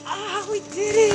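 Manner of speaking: high, happy exclamation; triumphant relief. Real, not acted. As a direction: sing out the success. Celebrating the release.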